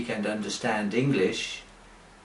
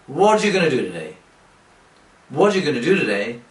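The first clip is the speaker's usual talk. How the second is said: rattled off fast, words run together; slurred fast English with gonna; 4 dB louder, much faster than usual. Shown as a demonstration. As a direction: gabble it like a native speaker in a rush. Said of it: "English people speak very quickly".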